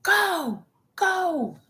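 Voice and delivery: high-pitched urging cry; hammed-up retold urgency; imitated urgent staff. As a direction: wave her through with high calls. Imitates gate staff urging her on.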